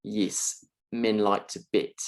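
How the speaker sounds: New Zealand vowels: "Yes" as "yis", "men" as "min", "bet" as "bit"; New Zealand accent. Shown as a demonstration. Lecturer demonstrating the E sound to students, New Zealand accent. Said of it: "sounds a little bit like".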